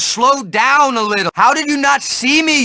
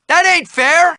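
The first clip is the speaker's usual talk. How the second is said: indignant; 7 semitones higher than usual. Acted. Indignant complaint.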